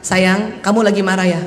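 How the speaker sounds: low, gentle question; caring mother. Acted. Mother asking her son if he's angry, gently. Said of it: "Kalau ibu tanya anak laki".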